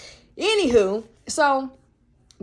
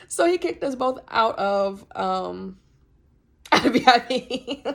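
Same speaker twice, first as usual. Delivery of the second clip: louder, laughing through the words; laughing while talking; 4 semitones higher, 6 dB louder, slower than usual. Real, not acted. Telling friends they got kicked out, laughing. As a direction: laugh your way through the line.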